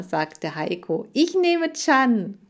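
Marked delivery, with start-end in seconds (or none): 1.1-2.4 high, loud, drawn-out announcement; eager claim. A narrator's reading. Boy picks his favourite hamster, eager claim. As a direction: call dibs, stretching the words.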